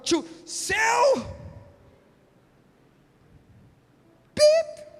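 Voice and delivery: mock road rage. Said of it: "um carro cortando".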